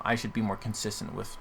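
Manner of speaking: usual speaking voice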